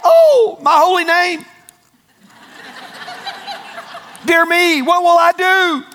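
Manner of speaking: high, loud theatrical fretting; overdone high-pitched dismay; mock freak-out. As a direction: ham up a helpless panic. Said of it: "He wasn't freaking out".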